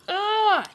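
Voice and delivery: high, loud falling scream. Acted. Engines screaming as they're pushed into the water. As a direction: scream as you fall in. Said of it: "they cried".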